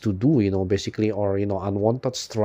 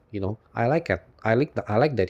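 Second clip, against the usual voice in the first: ordinary delivery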